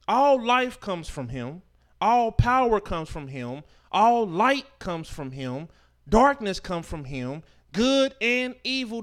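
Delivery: loud, slow sermon build; preacher proclaiming, full force; forceful preaching. Acted. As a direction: thunder it out from the pulpit. Preaches the Creator's power.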